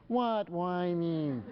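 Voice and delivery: high, very drawn-out whine; mock kid whine, slow and high; whining child. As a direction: stretch it into a whiny denial. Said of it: "kids who whine".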